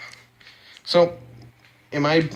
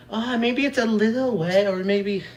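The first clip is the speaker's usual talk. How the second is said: higher-pitched, noncommittal mock voice; wishy-washy fence-sitters; 7 semitones higher than usual. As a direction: mimic someone who won't pick a side, pitched up. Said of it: "these neutral people being like".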